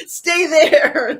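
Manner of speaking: high, loud mock command; rough handling. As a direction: imitate a rough order, playfully. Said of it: "he kinda rough handles her".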